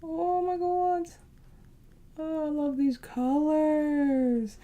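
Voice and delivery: slow, high, drawn-out delight; delighted gush. Real, not acted. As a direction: swoon over the colors. Admiring the palette colors.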